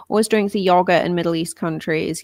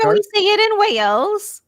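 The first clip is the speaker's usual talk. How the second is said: put-on Swansea accent; 13 semitones higher, 6 dB louder than usual. Playing up the accent.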